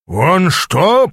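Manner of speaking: high, rising roar of disbelief; incredulous roar. A narrator's reading. Аас to the unexpected critic, roaring in disbelief. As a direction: roar the question in outrage. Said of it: "проревел Аас".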